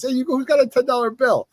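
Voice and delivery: put-on high-pitched New York kid hailing his crew; Jersey New York accent. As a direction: call round the gang in a Jersey accent. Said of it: "says it with such a better accent".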